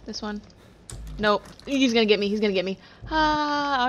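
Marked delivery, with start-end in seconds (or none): 1.6-3.9 loud panicked voice; scared and pressed; being chased. Real, not acted. Trapped with monster near.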